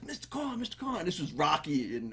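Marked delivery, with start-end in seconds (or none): 0.0-1.0 put-on high, quiet, pleading kid voice; eager guy begging for a minute; insecure young guy voice. Guy pleading softly to neighbor for attention. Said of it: "So he goes".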